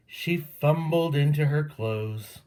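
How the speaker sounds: usual speaking voice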